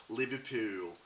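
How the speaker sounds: Liverpool said in Scouse with a stretched final vowel; Scouse accent on Liverpool. Shown as a demonstration. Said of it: "is known as Scouse".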